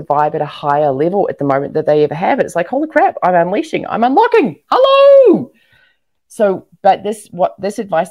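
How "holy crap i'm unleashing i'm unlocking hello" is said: mock excited squeal. Voicing women's breakthrough excitement.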